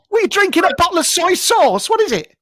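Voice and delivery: normal talking voice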